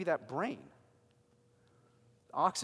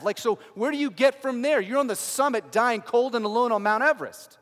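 urgent preaching; 7 semitones higher, 12 dB louder than usual. Real, not acted. Preacher pressing the lost seeker.

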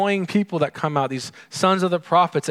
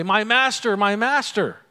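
wrong cry; 6 semitones higher than usual. Retold or imitated. Elisha crying out to his master, raised voice. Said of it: "He doesn't say".

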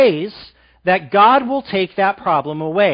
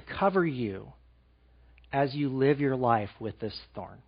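soft, low reassuring voice of God; softer, lower tender assurance; 5 semitones lower, 9 dB quieter than usual. Acted. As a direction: comfort him gently as God. God's words, soft.

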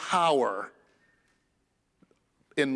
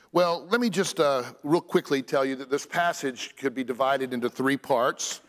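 ordinary talk

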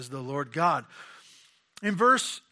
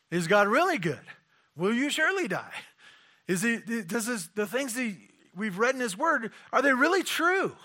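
questioning tempter; 6 semitones higher than usual. Pretend. Voices the doubts of temptation.

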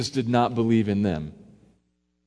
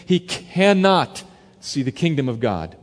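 raised, slow and solemn; solemn earnest; 6 semitones higher, 4 dB louder, slower than usual. Acted. Jesus solemnly pressing Nicodemus, raised and slow. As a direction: press the truth home, gravely. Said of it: "deadly serious here".

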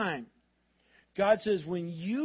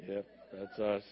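low, soft, deflated sigh of a tired parent; weary teen-parent; 10 semitones lower, 7 dB quieter, slower than usual. Retold or imitated. Pastor imitating parents of teenagers, low and soft. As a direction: mutter it like worn-out parents of teens. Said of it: "teenager parents are like".

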